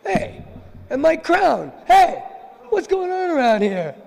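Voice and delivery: very high, loud indignant yelling; Krishna outraged at finding his flute stolen; outraged discovery. Acted. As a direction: catch the real thieves red-handed.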